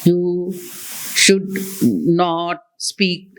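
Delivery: halting, a long pause after each word. Shown as a demonstration. Trainer showing a bad exam habit, long pauses between words. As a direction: break it up word by word with big gaps. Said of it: "Again, there's a pause like that".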